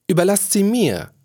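high, shrill screech from the jester. A narrator's reading. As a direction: screech it out high above the noise. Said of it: "kreischte jetzt Topfrosch auf mit seiner schrillen Stimme". (schrill aufkreischend)

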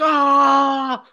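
high, loud mock scream; retold scream. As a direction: shriek it like the car is out of control.

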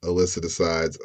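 ordinary talk

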